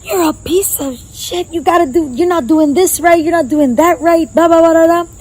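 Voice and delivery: hammed-up angry coach; rude coach yelling. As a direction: play a coach berating his captain. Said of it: "you're like".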